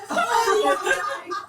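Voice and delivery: high, crying laughter; helpless laughing shriek; laughing till it sounds like crying. Real, not acted. Reacting to the gummy reveal.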